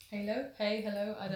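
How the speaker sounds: normal talking voice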